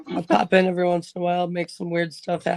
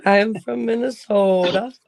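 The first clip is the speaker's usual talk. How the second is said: Minnesota accent; 3 semitones higher, slower than usual. Real, not acted. Newcomer answers where she's from, in a slow Minnesota accent. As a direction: say where you're from in a long, stretched Minnesota accent. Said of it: "She said it different".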